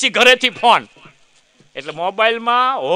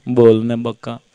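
put-on young lover on phone; 11 semitones lower, slower than usual.

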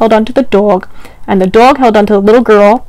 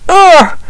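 a hammed-up, strained pulling grunt, higher; effort grunt; 8 semitones higher, 3 dB louder than usual. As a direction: grunt as if tugging hard. Pulling the turnip together.

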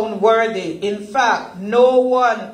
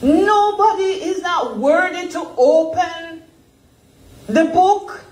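a mock weeping cry, voice pitched higher; weeping John; 8 semitones higher than usual. Preacher voicing John lamenting no one is worthy, higher, mock tearful. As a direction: plead as if near tears. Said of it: "And he began to weep".

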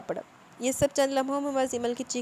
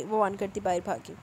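ordinary delivery

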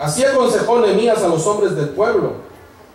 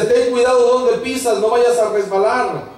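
higher-pitched urgent warning, acted; padre que advierte; 6 semitones higher than usual. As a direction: imitate a parent calling a warning to the cub. León advierte a su cría. (advertencia paternal imitada)

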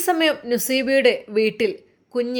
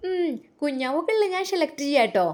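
put-on child voice; 5 semitones higher than usual. Little girl in toy shop.

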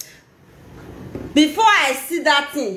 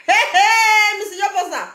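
high, raised-voice "hey, hey"; calling out; 7 semitones higher than usual. Real, not acted. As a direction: call her out in a high, insistent voice. Calling out Missus Yobosa in a rant.

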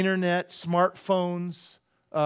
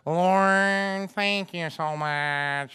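droning prayer, long drawn-out words on one note; monotone praying lady; 2 semitones higher, much slower than usual. Retold or imitated. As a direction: imitate a slow, monotone pray-er. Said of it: "this monotone voice".

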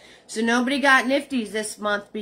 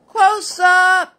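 hammed-up announcement; 9 semitones higher, 10 dB louder than usual. Announcing the close-up shot.

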